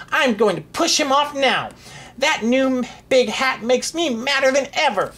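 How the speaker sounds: high, villainous rant; defiant anger. A narrator's reading. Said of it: "talked back to the king".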